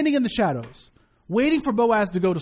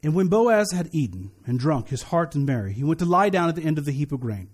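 measured, lower-pitched Bible reading; scripture reading; 4 semitones lower than usual. Real, not acted. Preacher reading Ruth chapter three to the standing congregation, scripture reading. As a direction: read the verses out formally to a congregation.

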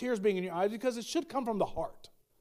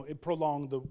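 ordinary talk

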